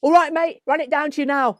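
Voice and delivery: loud, high fake-cheery call; forced cheer. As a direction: cover the panic with brightness. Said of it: "And I'm going".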